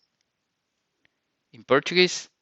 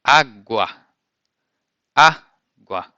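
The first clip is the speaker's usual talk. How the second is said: água said louder, the first syllable á hit hard; stress on first syllable; 7 dB louder, slower than usual. Shown as a demonstration. Teacher stressing the start of água for learners. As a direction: hit the á in água and drop the gwa. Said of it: "the strong part of the word is in the beginning".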